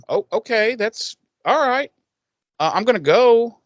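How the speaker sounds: mock-awkward, high-pitched backing away; awkward retreat. Buffy excusing herself after his confession, awkward high voice. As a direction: voice climbs as you politely escape.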